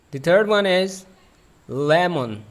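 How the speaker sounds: ordinary talk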